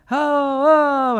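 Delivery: high, loud mock yell; retold yelling kids. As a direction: shout a frantic warning. Kids yelling warning at car.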